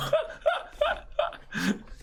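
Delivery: airy high giggle; breathy high laugh. Real, not acted. Laughing at odd scene.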